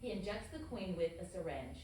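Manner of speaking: normal talking voice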